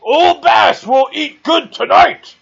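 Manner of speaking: high, loud, slow furious bellow; raging shout. Acted. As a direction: roar it out in a berserk rage. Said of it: "he just starts going".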